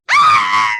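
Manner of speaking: loud, piercing pretend scream; full-force storybook scream; biggest princess scream. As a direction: let rip with a huge shriek. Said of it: "let rip with the biggest scream".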